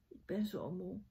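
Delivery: soft, sleepy murmur; exhausted, barely voiced muttering; tired mumble. A narrator's reading. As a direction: mumble it as you drop off. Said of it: "mompelde hij toen".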